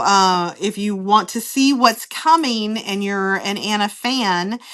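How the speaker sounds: ordinary talk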